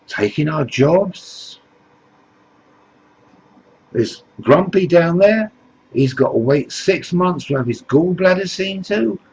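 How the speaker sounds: Sneezy the dwarf. Acted. Sneezy complains to Jesus Farage, mock whining. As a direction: play the dwarf moaning about migrants. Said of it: "And Sneezy says".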